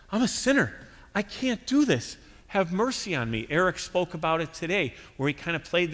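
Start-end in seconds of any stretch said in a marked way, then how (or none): 0.1-3.5 a lightly voiced sinner's plea, pitched much higher; pleading sinner. Preacher voicing a sinner's confession, high pleading. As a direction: plead for mercy, higher than the preacher's own voice.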